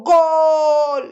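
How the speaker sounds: a loud, high, excited "gol!"; goal shout. Shown as a demonstration. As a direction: yell it like a fan celebrating a goal. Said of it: "Por ejemplo, si digo".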